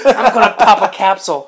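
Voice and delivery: loud, high comic shout; hammed-up yell amid laughter; mock threat. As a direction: belt out the absurd threat for laughs. Repeats absurd threat amid laughter.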